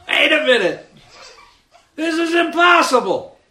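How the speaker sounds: high, loud mock panic; sudden loud disbelief, voice jumping way up; alarmed realization. Preacher acting out Peter realizing on the water, comic alarm. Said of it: "He, he realized".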